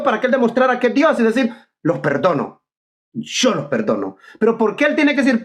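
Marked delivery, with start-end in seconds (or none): 1.8-4.2 voz grave de autoridad divina, imitada; cita solemne. Retold or imitated. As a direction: pronuncia el perdón bajo y pausado, como Dios. Voz de Cristo como Dios, imaginada. (imitación solemne, grave y pausada)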